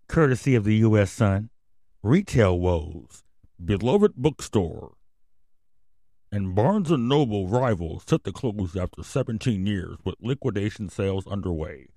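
mock news anchor read; newscaster voice. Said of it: "don't you love my newscaster voice".

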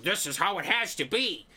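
imitating a stubborn know-it-all; hammed-up stubborn insistence; lightly mocking a closed-minded fan; mock bullheaded. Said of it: "bullheaded enough to be like".

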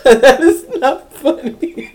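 protesting through laughter, slow and broken; real helpless laughter. Real, not acted. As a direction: try to scold while cracking up.

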